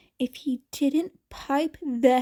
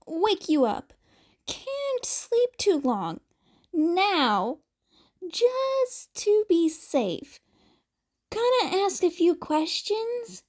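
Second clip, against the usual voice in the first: Present Mike, gently checking; 6 semitones higher than usual. Acted. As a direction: ask carefully, like a friend keeping him awake. Mike checking on concussed teen.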